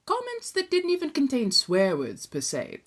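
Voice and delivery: ordinary delivery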